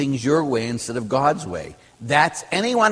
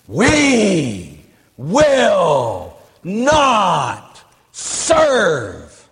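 each word hugely stretched, wailed out; mock screaming nun; 3 semitones higher, 3 dB louder, much slower than usual. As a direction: imitate her yell, pounding out word by word. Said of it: "she was screaming and yelling".

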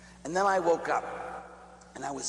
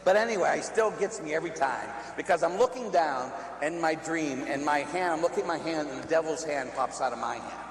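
normal talking voice

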